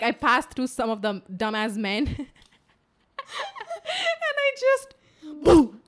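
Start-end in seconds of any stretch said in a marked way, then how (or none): 5.4-5.8 sudden explosive dog-like bark; one sharp, higher bark; loud bark. Retold or imitated. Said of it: "I barked so".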